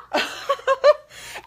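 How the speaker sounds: high, loud laugh close to crying; teary laugh. Real, not acted. Overwhelmed by a supporter's comment, a loud teary laugh. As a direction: let the laugh crack with emotion. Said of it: "I'm gonna cry".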